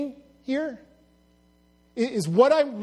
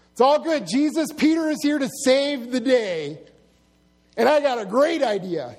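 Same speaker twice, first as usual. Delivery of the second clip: lightly acted, higher, louder bluster; eager Peter; 4 semitones higher, 4 dB louder than usual. Peter telling Jesus he is here to save the day, eagerly. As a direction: play a confident would-be hero, pitched up.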